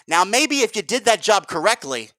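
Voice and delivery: ordinary talk, nothing marked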